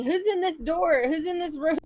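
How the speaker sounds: yell. Acted. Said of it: "I just yell at him, pointing".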